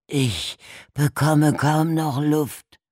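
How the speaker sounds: high, loud, slow and frantic; begging for air, terrified; desperate gasping plea. A narrator's reading. Buried man begs Darby through the pipe, gasping plea. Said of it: "Walters raue, verzweifelte Stimme".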